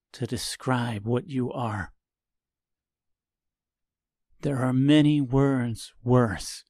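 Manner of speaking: lightly voiced judgement, held back; cold quiet disgust. Tip condemns Reginald.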